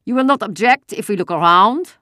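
put-on loud, high German-accented officer; a question that is really an order; harsh demand. A narrator's reading. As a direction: push it out hard, no room for refusal. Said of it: "The voice was harsh, and it was not a question".

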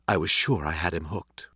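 plain reading voice

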